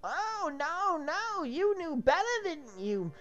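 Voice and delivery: high-pitched told-you-so rant; gloating high scold; high nagging. Acted. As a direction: nag them in a high whine. Tim berates the knights.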